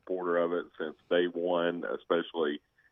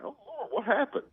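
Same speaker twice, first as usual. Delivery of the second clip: alarmed dad. Retold or imitated. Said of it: "I'm like".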